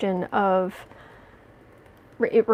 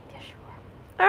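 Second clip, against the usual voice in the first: whispering to cat. Real, not acted. Owner whispering praise to her cat. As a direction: breathe it to the kitty.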